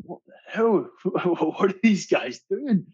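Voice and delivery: a baffled outburst that breaks into a laugh; retold disbelief. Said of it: "And I'm thinking".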